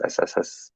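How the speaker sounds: soft unvoiced snake hiss. Shown as a demonstration. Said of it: "It's a hissing".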